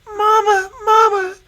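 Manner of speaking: high, loud call for mother; little girl shrieking for her mother as the wolf lunges; crying out. Acted. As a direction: scream for your mother in fright.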